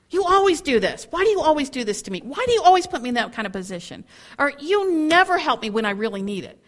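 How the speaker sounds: high, loud accusations, fast in places; accusing rant. Retold or imitated. A speaker acting a spouse's attack on character, accusing rant. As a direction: pile on the blame.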